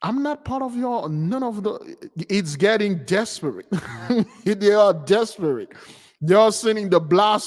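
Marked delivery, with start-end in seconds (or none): 0.0-2.1 baffled quote. Retold or imitated. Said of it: "You're like".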